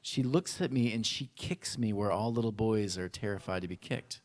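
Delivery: usual speaking voice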